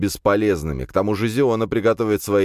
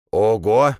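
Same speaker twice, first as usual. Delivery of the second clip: louder, higher cry of amazement; exclaiming; 5 semitones higher, 5 dB louder than usual. A narrator's reading. Old priest sees how the cat has grown, exclaiming. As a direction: burst out impressed, pitch and volume up. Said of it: "воскликнул старик".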